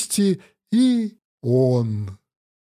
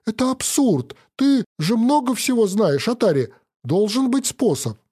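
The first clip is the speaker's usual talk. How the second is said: desperate; 7 semitones higher than usual. Acted. Hero desperate with Atari.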